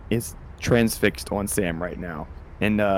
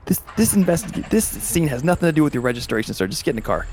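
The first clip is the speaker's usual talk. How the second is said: higher exasperated order; cop insisting; 6 semitones higher than usual. Acted. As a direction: cut him off, insist. Cop arguing with player.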